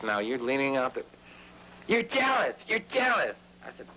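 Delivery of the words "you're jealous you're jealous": mock accusing girlfriend. Retold quarrel with girlfriend.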